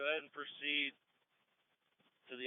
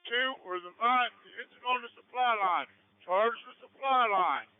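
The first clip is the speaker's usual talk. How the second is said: shouting; 8 semitones higher, 6 dB louder than usual. Real, not acted. Engine operator shouting on radio.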